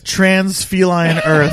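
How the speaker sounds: slow, loud, laughing through it. Real, not acted. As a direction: crack up while saying it. Joke coinage, laughing.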